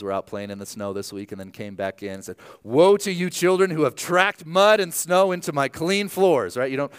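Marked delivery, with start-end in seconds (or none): 2.6-6.6 put-on biblical curse on muddy children, high and emphatic; mock solemn parent. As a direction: pronounce doom over dirty floors like a prophet. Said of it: "we don't really talk like this anymore".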